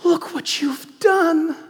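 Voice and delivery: breathy start then a loud, high, slow accusing cry; Scar's false accusation. Acted. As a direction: whisper Oh Simba, then rise to a shocked high accusation. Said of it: "Scar turns ... and says".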